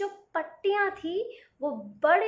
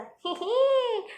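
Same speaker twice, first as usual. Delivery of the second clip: higher, hearty laugh; boatman laughs; 8 semitones higher than usual. Acted. Boatman laughing at Golmatol Ram's shoe question, hearty laugh. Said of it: "नाव वाला हंसा".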